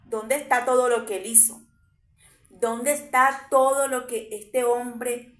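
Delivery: full-force sermon delivery; fiery preaching. Acted. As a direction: hammer each question home to the congregation. Preacher voices doubting question.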